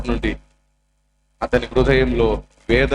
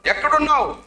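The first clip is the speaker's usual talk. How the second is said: eager calling; 11 semitones higher than usual. Retold or imitated. Boy startled, calling unseen voice.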